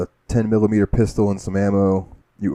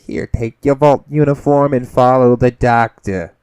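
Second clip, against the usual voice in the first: bossy vault lady; 4 semitones higher, 8 dB louder than usual. Retold or imitated. Vault lady handing out uniforms.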